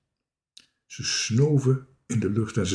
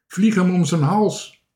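high, loud call; 9 semitones higher, 5 dB louder than usual. A narrator's reading. Dikke Druif calls up to Toontje, high shout. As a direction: shout it up to the tree. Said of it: "riep Dikke Duif".

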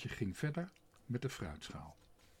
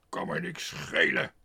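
defiant cook shouting; 8 semitones lower, 10 dB louder than usual. A narrator's reading. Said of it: "riep Tos".

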